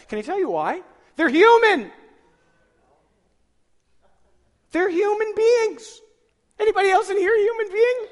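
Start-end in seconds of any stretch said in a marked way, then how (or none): 1.1-8.1 high, full-force preacher's yell; loud, high-pitched exclaiming; emphatic shout. Real, not acted. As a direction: holler the point out to the whole room. Preacher yells his key point.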